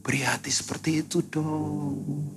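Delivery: soft, slow whisper to God; hushed, longing whispered prayer. Retold or imitated. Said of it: "saya sampai bilang gini".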